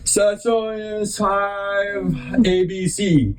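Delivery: high, very slow singsong recital; imitated child reciting. Kid reciting the thing for proud parents, mock slow high singsong. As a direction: drag it out like a kid reciting by rote. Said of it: "You know, like that".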